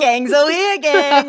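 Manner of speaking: high, giggly mock announcement; mock announcing. Joining co-host's silly reveal bit, mock announcing. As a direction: call it out brightly, breaking into laughter.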